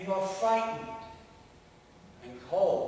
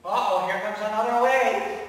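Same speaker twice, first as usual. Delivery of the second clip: alarmed loud cry; 4 semitones higher, 11 dB louder than usual. Acted. Storm scene continues.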